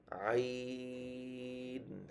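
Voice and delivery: আইন held very long from mid-throat; held Arabic letter. Shown as a demonstration. Teacher demonstrating the letter আইন from the throat, long held. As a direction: stretch আইন from the middle of the throat. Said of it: "হলকের মাঝখান থেকে".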